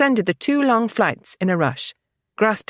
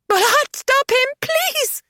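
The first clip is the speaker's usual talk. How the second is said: screaming plea; slower than usual. A narrator's reading. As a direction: shriek for help in terror. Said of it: "Evie screamed".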